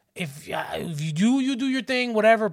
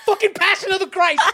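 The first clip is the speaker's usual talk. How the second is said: loud, high, hammed-up demand; mock insistent partner; 13 semitones higher, 6 dB louder than usual. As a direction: push the idea with comic zeal. Imitates partner insisting on the film.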